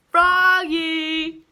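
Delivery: loud, high call of the name. Acted. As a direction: call him sharply across the room. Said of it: "called his father".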